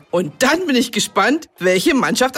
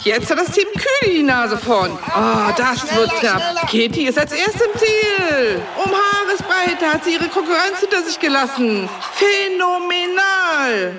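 live sports commentary; 6 semitones higher than usual. Acted. Teacher commentating the children's race, excited play-by-play. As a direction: race play-by-play rising to a high finish.

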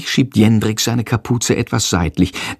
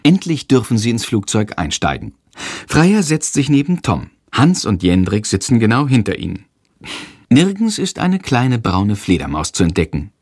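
ordinary delivery

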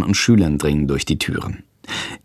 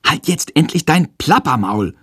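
exasperated call, pitched up; too-loud outburst; 6 semitones higher than usual. A narrator's reading. Pupil accidentally calling out in class, slightly too loud. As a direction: blurt it out a touch too forcefully. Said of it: "ruft er aus Versehen etwas zu laut".

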